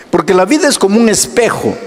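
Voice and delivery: ordinary talk, nothing marked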